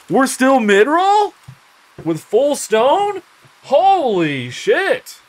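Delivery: high, loud, drawn-out disbelief; amazed. Real, not acted. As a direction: shout out in astonishment. Amazed at armor result.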